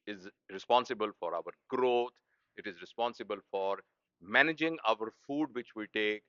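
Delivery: ordinary talk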